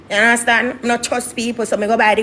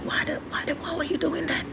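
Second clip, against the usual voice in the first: whispery, much softer quoted voice; imitated hushed question; 5 semitones higher, 11 dB quieter than usual. Office lady questions her about the incident, hushed imitation.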